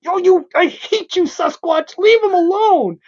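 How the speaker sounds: high-pitched retold childhood yell at a comic villain; kid yelling at the page. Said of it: "I remember yelling at the page".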